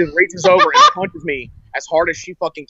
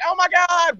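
much higher, imitating a terrified fiancée; panicked gasp; 14 semitones higher, slower than usual. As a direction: mimic her panic in a high squeal. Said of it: "she's like".

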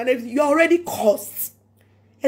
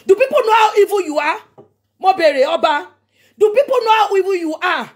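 high, loud, shouted challenge; furious accusation; 4 semitones higher, 4 dB louder than usual. Real, not acted. Challenging the king before the public, shouting in anger. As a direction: hurl the question at him.

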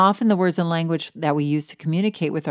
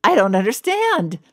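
confused; 6 semitones higher, 4 dB louder, slower than usual. Retold or imitated. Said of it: "I was like".

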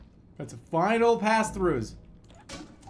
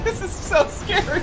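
nervous laugh; 13 semitones higher, 4 dB louder than usual. Real, not acted. Scared and laughing.

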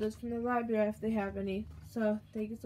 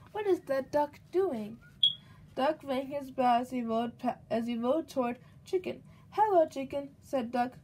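lively, louder storybook read-aloud; reading to a child; 3 semitones higher, 5 dB louder than usual. A narrator's reading. Mother reading a picture book to her baby, lively storybook voice. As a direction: read it out brightly for the little one.

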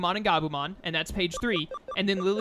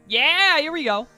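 higher, louder happy yell; excited cheer; 8 semitones higher, 7 dB louder, slower than usual. Real, not acted. Excited at next event.

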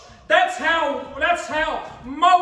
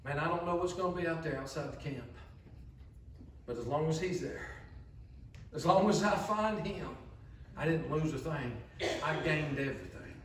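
hushed, calm devoted believer; quietly assured believer; content believer; 10 semitones lower, 13 dB quieter than usual. Retold or imitated. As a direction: speak softly as someone at peace.

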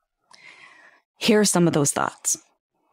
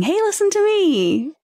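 higher, mock call for attention; 12 semitones higher than usual. Host mimics her own podcast pleading for attention, playful call. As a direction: pitch it up like waving your arms for notice. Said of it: "I'm saying".

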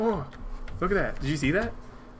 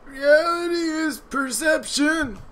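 dramatic NPC voice; 9 semitones higher, slower than usual. Acted. Reading an NPC's wisdom aloud in a high slow dramatic voice. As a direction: proclaim it like a wise old villager. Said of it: "Are you really gonna read everything they say?".

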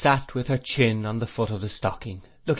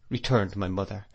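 ordinary delivery, nothing marked

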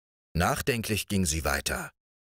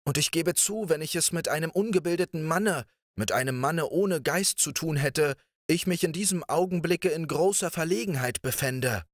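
lightly voiced princess, higher and earnest; assuring princess; 9 semitones higher than usual. A narrator's reading. The princess assures Riquet, lightly voiced and higher. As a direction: reassure him, a touch flattering. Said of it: "versicherte die Prinzessin".

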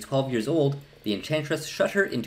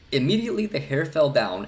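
usual speaking voice

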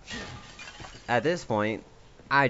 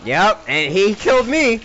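exasperated yell; 9 semitones higher, 9 dB louder than usual. Real, not acted. Exasperated after dying.

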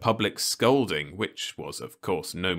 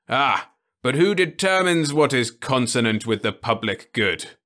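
higher, louder knowing challenge; sly old man; 6 semitones higher, 6 dB louder than usual. Acted. Osmond challenges Ludovic's reasoning, knowing old voice. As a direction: catch him out.

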